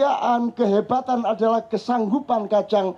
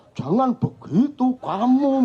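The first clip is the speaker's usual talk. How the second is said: mock Javanese accent; 2 semitones higher, slower than usual. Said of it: "Jawa-Jawakno".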